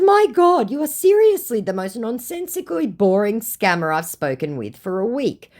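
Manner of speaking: high, exasperated, scornful; fed up and lofty. Retold or imitated. Her own retort, acted.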